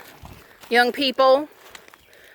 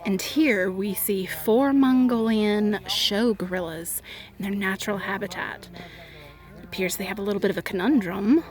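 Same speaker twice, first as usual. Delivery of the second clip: nature documentary; 3 semitones lower, 7 dB quieter than usual. Pretend. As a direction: narrate like a hushed nature show. Mock wildlife narration about tractor riders.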